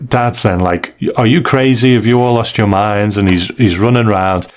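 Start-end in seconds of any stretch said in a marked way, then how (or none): none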